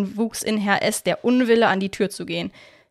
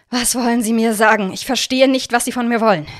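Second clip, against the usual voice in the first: clearly irritated, voice raised; 3 semitones higher, 6 dB louder than usual. A narrator's reading. Herr S, irritated by the visitors' questioning. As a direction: snap back, fed up. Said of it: "sagte er mittlerweile gereizt".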